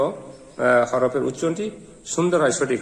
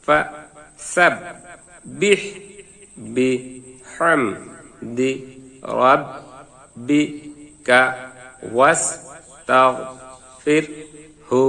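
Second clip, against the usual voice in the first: each syllable said alone with long gaps. Shown as a demonstration. Teacher to students, spelling out the verse syllable by syllable. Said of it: "আবার ভাঙ্গা ভাঙ্গা উচ্চারণ".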